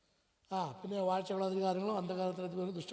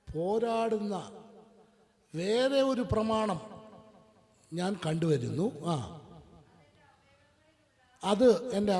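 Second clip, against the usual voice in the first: slow, deliberate scripture reading, louder near the end; 2 semitones higher, 5 dB louder, slower than usual. Shown as a demonstration. Preacher reading Romans slowly to the congregation. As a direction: read each phrase slowly, weighing it. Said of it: "മെല്ലെ, മെല്ലെ, മെല്ലെ വായിക്കണേ".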